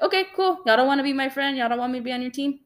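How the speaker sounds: high mock-cheery "okay, cool", then quick; sing-song sarcastic dismissal, speeding up; sarcastic brush-off. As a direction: airily dismiss them. Brushing off former friends, retold.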